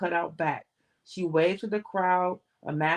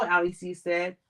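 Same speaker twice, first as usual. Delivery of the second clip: plain reading voice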